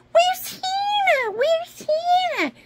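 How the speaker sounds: high, drawn-out wailing question; pretend tearful doll. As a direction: wail it as an upset little doll. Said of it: "It's all right".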